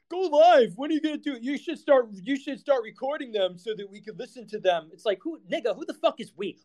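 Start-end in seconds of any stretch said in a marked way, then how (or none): 0.1-4.9 higher, pleading mock voice of reply guys; mock whiny fan. Streamer mocking fans in his replies, high whiny voice. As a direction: mimic the nagging fans in a high voice. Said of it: "these people in my replies".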